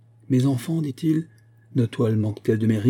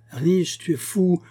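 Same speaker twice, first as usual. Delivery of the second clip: incredulous; 7 semitones higher, slower than usual. Acted. Friend scoffs at Karl's plan.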